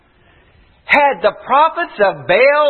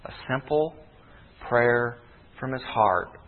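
hushed altar-call preaching; 8 semitones lower, 6 dB quieter than usual. Acted. Preacher to tired congregation before the altar call, hushed and soft. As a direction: speak quietly as if to a hurting crowd.

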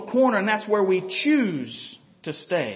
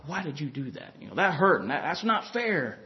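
sulking; 5 semitones lower, 4 dB quieter than usual. Retold or imitated. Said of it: "kinda sulk and pout".

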